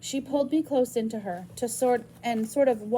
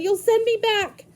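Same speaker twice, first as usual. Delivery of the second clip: panicked plea; 11 semitones higher, 9 dB louder than usual. Acted. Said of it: "I said".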